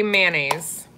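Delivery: "mayonnaise" as MAN-AYS, short a, middle squeezed; her own mayonnaise. Shown as a demonstration. Showing viewers how she says it, mayonnaise as man-naze. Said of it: "I say mayonnaise".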